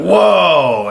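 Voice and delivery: mock shock at stumbling on something; retold startled whoa. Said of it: "and I went".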